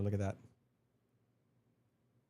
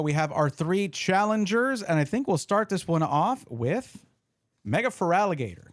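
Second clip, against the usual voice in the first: slow, raised announcer voice; 6 semitones higher, 5 dB louder, slower than usual. Acted. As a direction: introduce the challengers like a ring announcer. Announces the battle challengers.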